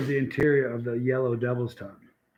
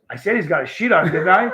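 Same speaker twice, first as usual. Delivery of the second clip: louder, mock-exasperated comeback; retold insistent retort; 3 semitones higher, 7 dB louder than usual. Al retold snapping back during his prank, insistent. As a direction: re-enact the prankster insisting forcefully. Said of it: "And Al goes".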